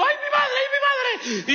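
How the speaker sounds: high, frantic cry of alarm; panicked screaming. Retold or imitated. A driver screaming as the car slides in snow. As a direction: shriek it as the car spins out.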